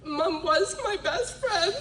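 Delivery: slow, voice catching with tears; tearful. Real, not acted. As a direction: say it through tears, slowly. Grieving line about late mother.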